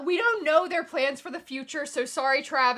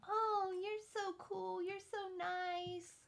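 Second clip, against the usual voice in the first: soft, high, slow mock sweetness; drawn-out, hushed phony compliments; fake nice; 6 semitones higher, 8 dB quieter, slower than usual. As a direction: imitate a two-faced flatterer, cooing softly. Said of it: "he's real nice to my face".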